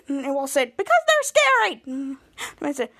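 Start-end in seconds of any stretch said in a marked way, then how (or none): 0.8-1.7 much higher, defensive squeal; mock frightened whine. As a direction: insist on it in a high whine. Scared character defends himself.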